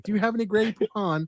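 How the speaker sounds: high, prim imitation of a hoity-toity Englishman. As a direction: ask it like a snooty English gentleman in the commercial. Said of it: "two hoity-toity Englishmen is like".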